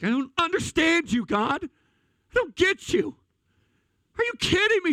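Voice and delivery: high, exasperated outburst at God; anguished protest. Retold or imitated. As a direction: cry out to God in disbelief, pitch soaring. Retold outburst at God in grief.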